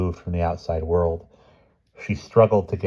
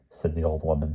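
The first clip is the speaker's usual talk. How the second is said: usual speaking voice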